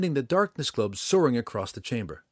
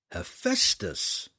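higher, slow drawn-out call; crying out; 6 semitones higher, much slower than usual. A narrator's reading. Drizzt hails the dragon he means to flatter, crying out. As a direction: call the dragon's name, stretched out. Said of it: "he cried in the common tongue".

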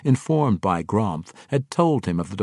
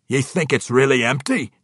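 loud, raised dwarvish question; questioning dwarf king; 6 semitones higher, 6 dB louder than usual. Acted. Dwarf king asking about the walled keep, loud and doubtful. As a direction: ask it loudly in a dwarf's brogue.

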